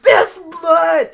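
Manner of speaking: very high, loud mock shriek; shocked yell. Friend retelling Todd finding blood in the bathroom, mock shriek. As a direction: yelp in hammed-up shock.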